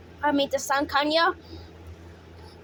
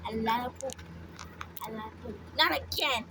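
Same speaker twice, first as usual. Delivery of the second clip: ordinary talk, nothing marked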